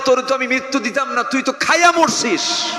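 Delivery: high, forceful voice of rebuke; God's rebuke. Acted. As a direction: preach it as God's accusation. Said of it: "আল্লাহ যদি বলেন".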